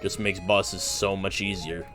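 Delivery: ordinary talk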